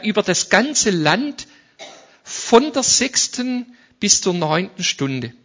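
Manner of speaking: slow, solemn Bible reading; scripture reading. Acted. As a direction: read the passage with weight. (feierliche Schriftlesung)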